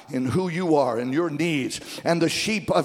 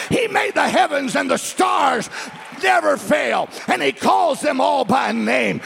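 high, shouted revival preaching; full-force preaching; 7 semitones higher, 4 dB louder than usual. Real, not acted. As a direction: fire up the congregation with raised, insistent pitch.

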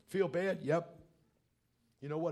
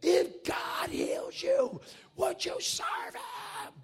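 much higher, strained scream, very slow; much slower than usual. Retold or imitated. Preacher screaming the question again at the deaf man, high and strained. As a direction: scream every word as if he can't hear you. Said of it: "I'm screaming at him".

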